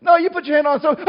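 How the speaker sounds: usual speaking voice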